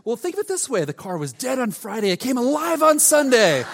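a playful jab with a pun; teasing wife. Retold or imitated. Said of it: "no compassion".

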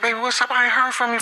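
high-pitched take on the wife; imitated wife. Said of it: "She like".

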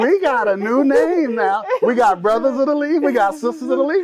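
high laughing announcement. Real, not acted. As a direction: laugh through the new title. Host laughing at the new title.

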